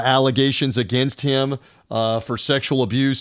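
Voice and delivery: ordinary talk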